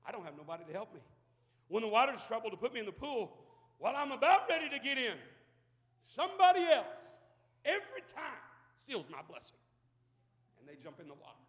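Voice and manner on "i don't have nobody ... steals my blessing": a mild whine rising to an outburst; complaining lame man. Acted. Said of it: "the infirmity man said".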